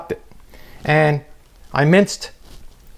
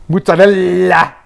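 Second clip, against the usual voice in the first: louder, slow, drawn-out mozzarella; hammed-up mozzarella; 4 semitones higher, 5 dB louder, slower than usual. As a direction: stretch "mozzarella" like a TV host. Mocking TV chef word.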